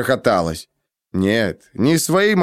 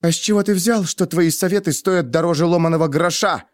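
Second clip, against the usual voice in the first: high-pitched angry challenge; explosive indignation; 7 semitones higher than usual. A narrator's reading. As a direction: burst out at him, offended. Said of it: "взорвалась Денна". (взрываясь от возмущения)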